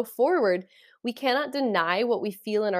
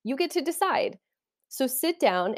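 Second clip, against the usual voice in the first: lightly imitated mystic teacher, pitched higher; Neville Goddard; 7 semitones higher than usual. Speaker quoting her favorite mystic's advice, lightly acted, higher voice. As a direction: lift the voice well up for the quoted teaching. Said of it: "he was always like".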